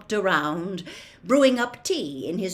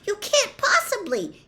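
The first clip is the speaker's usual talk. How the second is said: put-on high, louder girlish protest; aghast female voice; shocked; 5 dB louder than usual. A narrator's reading. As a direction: protest in disbelief, pitched way up. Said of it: "sounding shocked".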